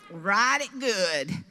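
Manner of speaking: very slow, drawn-out punchline; joking about her wheel of misfortune, very slow; slow comic drawl. Real, not acted. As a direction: lean on each word slowly.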